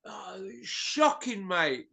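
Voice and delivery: breathy, slow whispered disgust. Real, not acted. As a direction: hush it out, appalled and slow. Disgusted aside about gifted decisions.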